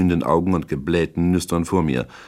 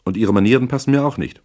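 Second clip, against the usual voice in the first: much higher, sharply offended retort; indignant snapping; 8 semitones higher, 4 dB louder, faster than usual. A narrator's reading. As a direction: snap at him, offended. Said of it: "fauchte sie".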